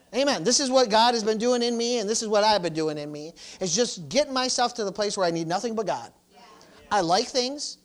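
high, loud pulpit conviction; fervent preacher driving each phrase home; full-force preaching. Real, not acted. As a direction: preach it as personal testimony, emphatically.

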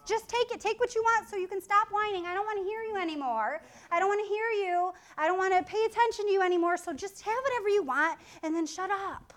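mom who just wants the whining to stop; exasperated mom. Retold or imitated. Mother giving in, exasperated.

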